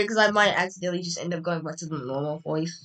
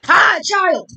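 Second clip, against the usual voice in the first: loud high greeting; 10 semitones higher, 10 dB louder than usual. Acted.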